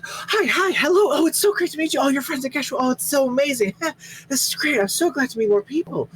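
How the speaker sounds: high, fast, gushing greeting; bubbly rush. Acted. Pearl rushing to greet the new friends, bubbly and high. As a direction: gush over everyone in a breathless rush. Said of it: "immediately rushes around".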